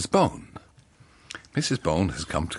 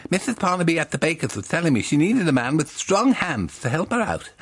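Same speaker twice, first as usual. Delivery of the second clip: higher, louder helpful John; 9 semitones higher, 3 dB louder than usual. Acted. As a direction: play the eager shop helper, pitched up. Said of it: "says John".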